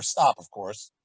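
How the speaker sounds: normal talking voice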